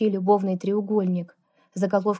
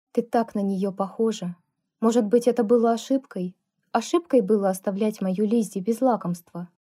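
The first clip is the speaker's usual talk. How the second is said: plain reading voice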